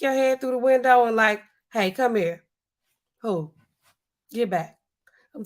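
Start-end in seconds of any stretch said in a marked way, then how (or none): none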